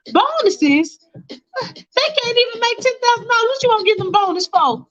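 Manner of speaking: high scornful mock disbelief; scoffing high wife. Faith scoffs at Michael offering staff bonuses, high and scornful. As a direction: scoff at the very idea.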